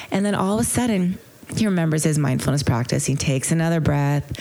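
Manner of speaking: normal talking voice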